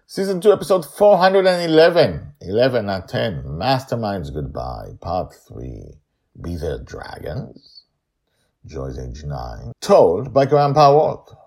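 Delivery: loud, high announcer call of the title; big radio-serial intro voice; episode title announcement. Acted. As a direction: proclaim the episode like a showman.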